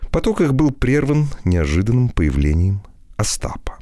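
ordinary delivery

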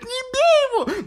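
very high shrieking plea; panicked scream. Retold or imitated. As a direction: shriek in fear for him. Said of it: "девушка начала кричать".

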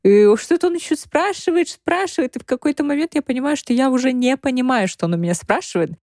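normal talking voice

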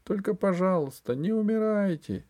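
high, pleading cry; tearful pleading. A narrator's reading. Alice to the dying empress, tearful plea. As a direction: beg her, near tears. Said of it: "заплакала она".

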